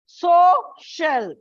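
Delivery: "Social" with SO high and loud; SO-cial, two clearly parted syllables; stress on first syllable. Shown as a demonstration. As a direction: punch the SO in "Social", then let it fall. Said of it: "first syllable".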